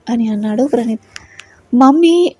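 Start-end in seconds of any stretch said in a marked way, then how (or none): 1.7-2.3 high, loud call for mummy; louder call out. A narrator's reading. Girl calls mother for help, loud high shout. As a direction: shout it out to be heard this time. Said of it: "కొంచెం గట్టిగానే అరిచింది".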